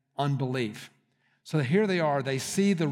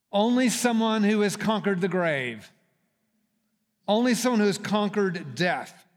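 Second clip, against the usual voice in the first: higher, louder, stress on Only; emphatic preaching; 5 semitones higher, 4 dB louder than usual. Acted. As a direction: stress on ONLY both times. Emphatic proclamation.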